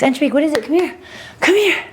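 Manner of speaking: high, rising call to a dog; calling a pet. Real, not acted.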